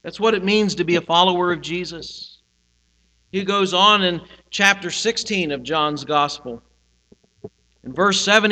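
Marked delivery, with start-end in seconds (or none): none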